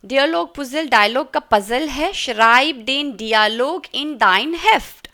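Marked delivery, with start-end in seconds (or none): 2.1-5.1 very slow and loud, word by word; slow German dictation. Shown as a demonstration. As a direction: read it slowly for pupils to copy. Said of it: "teacher models sentence slowly".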